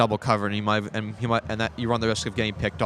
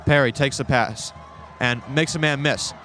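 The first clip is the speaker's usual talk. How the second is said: usual speaking voice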